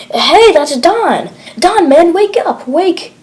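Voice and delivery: high, urgent cries to a downed friend; panicked calling out. Acted. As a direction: shake him awake with rising panic. Soldier finds fallen comrade.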